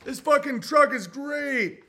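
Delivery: high, loud, slow happy dog; excited dog voice. Retold or imitated. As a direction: play a thrilled dog at the truck. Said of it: "They're like".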